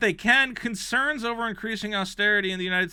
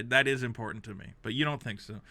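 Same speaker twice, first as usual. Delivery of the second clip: ordinary talk, nothing marked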